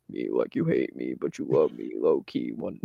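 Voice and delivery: very low, put-on deep voice. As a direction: drop into a deep voice. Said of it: "I'll just randomly go deep".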